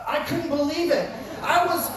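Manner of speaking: ordinary talk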